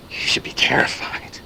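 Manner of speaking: urgent whispered warning. Acted. Narrator warning listeners they should be terrified, whispered. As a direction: hiss the warning under your breath.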